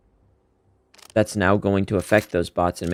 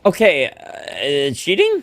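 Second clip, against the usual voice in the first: slow then high disbelief; can't believe it, cheating?; incredulous; 9 semitones higher, much slower than usual. Real, not acted. Reacts to suspicious kill.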